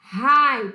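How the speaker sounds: Hi said as "rai", breathy h-sound and long ai; Hi with h like English h. Shown as a demonstration. Teacher showing students how Hi is pronounced, breathy h then ai. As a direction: say Hi with the h blown out like a Brazilian R, then ai. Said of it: "H tem som de R".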